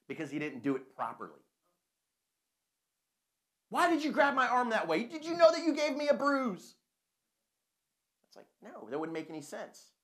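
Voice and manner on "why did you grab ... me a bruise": high, loud indignant complaining; whiny rescued victim. Acted. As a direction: whine at the fireman like a spoiled kid. Rescued person whining at fireman.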